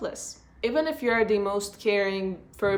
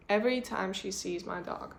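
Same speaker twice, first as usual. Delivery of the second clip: ordinary delivery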